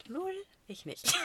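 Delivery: cheeky high-pitched smugness; smug. Real, not acted. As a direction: tease it out triumphantly. Smug punchline about ignoring him.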